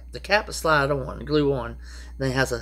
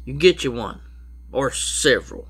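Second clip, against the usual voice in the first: normal talking voice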